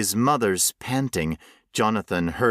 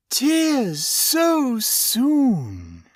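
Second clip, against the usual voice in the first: very slow, high, savoring creature voice; delighted creature; 3 dB louder, much slower than usual. Acted. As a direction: stretch out each word in gloating delight.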